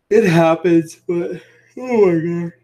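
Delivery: talking through a yawn; stretched, yawned words. Real, not acted. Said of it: "These yawns are killing me".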